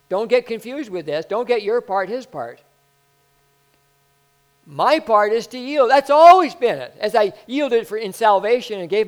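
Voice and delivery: loud, high preaching build; preaching at full force. Acted. Preacher pressing the point to the church, loud and high. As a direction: drive it home to the congregation.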